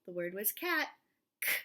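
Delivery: ordinary delivery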